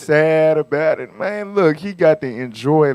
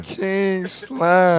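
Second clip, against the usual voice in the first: cartoon villain voice; 7 dB quieter than usual. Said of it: "sound like a cartoon character".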